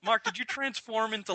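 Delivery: ordinary talk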